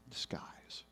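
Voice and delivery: whispered like a secret scheme; conspiratorial whisper. Acted. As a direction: whisper it as a sly villain's reveal. Villain king revealing his sly plan.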